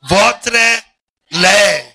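loud, high, drawn-out classroom drilling; mock a teacher chanting French possessives slowly; imitating French teacher. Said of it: "because of Messier".

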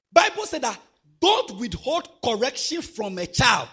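a preacher pressing the point hard; fiery preaching. Acted. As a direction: thunder the warning at the church. Preaching at full force.

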